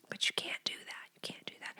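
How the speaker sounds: very soft, voiceless whisper; whispered aside. Real, not acted. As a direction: whisper it like a confidential correction. Hushed aside after wanting to bully.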